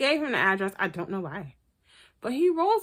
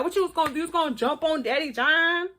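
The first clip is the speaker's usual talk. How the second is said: mock taunt; 8 semitones higher than usual. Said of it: "Lloyd was just standing there like".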